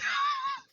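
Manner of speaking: squealing high laugh; laughing at their own silly gaming mistake. Real, not acted. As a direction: burst out laughing.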